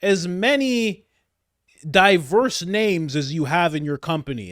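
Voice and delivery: high, loud mock Biden voice; imitating Biden. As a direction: play a squeaky, demanding Biden. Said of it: "Biden was like".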